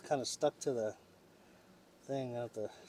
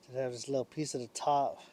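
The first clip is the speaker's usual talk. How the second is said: ordinary talk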